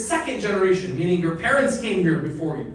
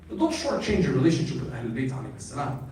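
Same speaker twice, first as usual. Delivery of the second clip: usual speaking voice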